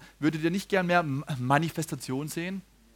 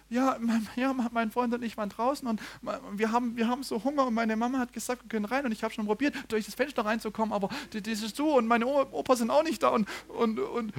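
put-on higher, hurried child's voice; boy explaining; 6 semitones higher, 3 dB quieter than usual. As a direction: tumble out the words like a nervous kid.

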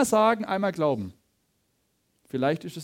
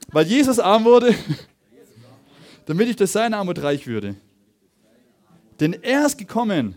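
raised proclamation, phrase by phrase; congregational declaration; 3 semitones higher, 4 dB louder than usual. Real, not acted. Preacher leading the congregation in scripture confession, firm proclamation. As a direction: declare it firmly, leaving room for repeats. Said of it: "Sagt mal mit mir".

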